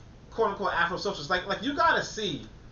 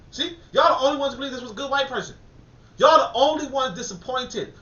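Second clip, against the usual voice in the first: higher, louder accusing call-out; exasperated raised voice; loud scolding; 6 semitones higher, 5 dB louder than usual. Real, not acted. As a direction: point the finger and call them out. Scolding disappointed people.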